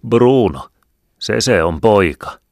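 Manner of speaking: loud, high hail from the comrades; comrades cheering. Acted. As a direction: call it out proudly, loud and high. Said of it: "Toverit sanoivat".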